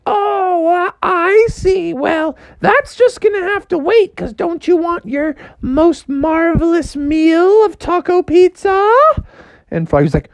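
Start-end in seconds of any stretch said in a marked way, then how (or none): 0.1-8.9 mom voice. Retold or imitated. Mom calling Froggy to fetch ingredients, high and sweet. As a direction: sing out to your son sweetly. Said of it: "she was like".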